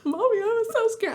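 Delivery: put-on high, small child's voice; little daughter. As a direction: play a frightened little girl. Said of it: "And she's like".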